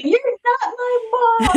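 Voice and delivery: high, slow, hammed-up whiny kid voice, laughing through it; mock the sulky child, half laughing; bratty stepkid. Stepmom imitating a resentful stepchild in a high whiny voice. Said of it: "kids saying".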